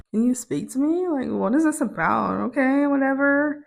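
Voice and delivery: quiet, awkward put-on reply; weird about it. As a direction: imitate a roommate being oddly cagey. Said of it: "real weird about it".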